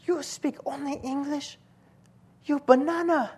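put-on high-pitched Chinese-accented lady; mock Chinese accent. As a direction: imitate a Chinese lady passing judgement. Imitates Chinese lady labelling him.

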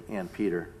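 "And Peter" said low and soft, settled; "And Peter" as a tender confirmation; quiet assurance. Shown as a demonstration. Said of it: "And truly he did say".

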